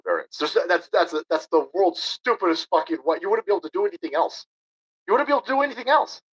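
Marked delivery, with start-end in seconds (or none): 5.1-6.2 much higher and faster, incredulous outburst; exasperated repeat. Real, not acted. Ranting streamer repeats point to chat, incredulous higher faster outburst. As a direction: blurt it again in disbelief.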